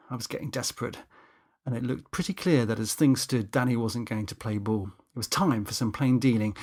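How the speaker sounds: ordinary delivery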